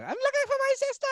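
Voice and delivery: put-on very high-pitched London girl accent; London accent impression. Lost girl to stranger at a club, high London accent. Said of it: "authentic London accent".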